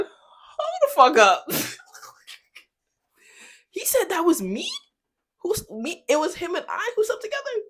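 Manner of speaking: high loud shocked demand to the friend; outraged shock. Retold or imitated. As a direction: stop everything in outrage. Shocked retort retold.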